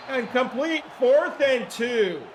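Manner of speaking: sudden loud call of the completion, then slowed; excited play call. Real, not acted. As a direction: streamer calling the sim's big completion, loudly excited. Calling a completed pass live.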